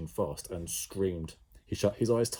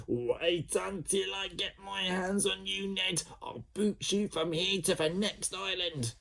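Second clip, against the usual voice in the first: furious sister; 11 semitones higher than usual. A narrator's reading. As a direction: promise revenge. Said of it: "Jemima furious threat".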